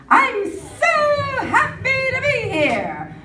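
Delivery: high, slow, sung-out chirp of joy; sing-song happy athlete. Retold or imitated. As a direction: sing it like a beaming Olympian. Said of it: "everybody is happy to be here".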